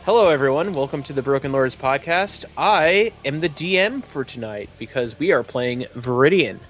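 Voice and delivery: ordinary talk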